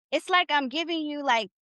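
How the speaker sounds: ordinary talk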